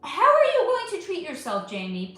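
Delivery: high, loud excited question; excited cheer. Real, not acted. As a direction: hype her up to treat herself. Cheering on a hardworking viewer.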